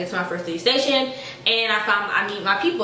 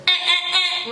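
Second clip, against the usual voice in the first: buzzer noise; 9 semitones higher, slower than usual. Pretend. Buzzer for wrong answer.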